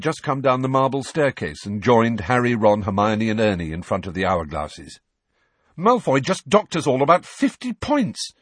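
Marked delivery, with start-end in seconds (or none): 5.8-8.3 higher, louder, angry; mild furious outburst. Acted. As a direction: spit it out in fury. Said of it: "said Harry furiously".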